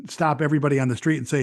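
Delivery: normal talking voice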